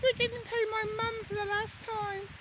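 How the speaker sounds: high, mock Welsh sheep voice; lightly put-on Welsh lilt, playing a sheep; Welsh accent. Joking about lambs' fathers, mock Welsh accent. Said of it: "you do a Welsh accent".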